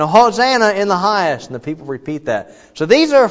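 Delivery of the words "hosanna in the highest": crowd response. Retold or imitated.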